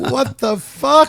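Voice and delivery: very high, loud incredulous squeal; high, loud mock outrage; shocked shriek. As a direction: squeal it in disbelief. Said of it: "I was like".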